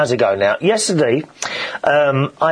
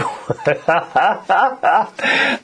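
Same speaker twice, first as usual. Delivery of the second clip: laugh; 3 semitones higher than usual. Real, not acted. Joking he is always right.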